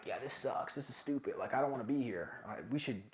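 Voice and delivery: low, muttered imitation of complainers; negative grumbling. Imagined teammates who want to quit, negative grumbling. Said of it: "negative and being like".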